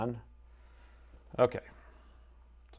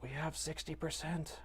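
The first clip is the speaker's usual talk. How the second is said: ordinary talk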